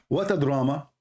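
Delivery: normal talking voice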